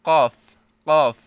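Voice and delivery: forceful back-of-throat k in কফ; with a push. Shown as a demonstration. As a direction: hit the q of কফ hard at the back. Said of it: "একটু ধাক্কা খাচ্ছে".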